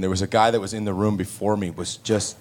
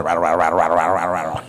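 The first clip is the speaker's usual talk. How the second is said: rattle; 5 semitones lower, much faster than usual. Retold or imitated. Imitating furnace rattling toy.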